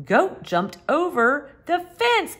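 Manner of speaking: usual speaking voice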